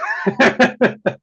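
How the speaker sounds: high, loud laugh. Real, not acted. Cracking up at his own fan story, laughing. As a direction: burst out laughing.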